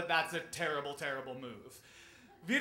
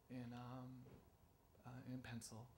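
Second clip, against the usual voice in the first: very soft, halting admission; meek, with reluctant pauses; sheepish confession; 4 semitones lower, 15 dB quieter than usual. Retold or imitated. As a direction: confess in a small, hesitant voice. Pupil reluctantly admits using pencil.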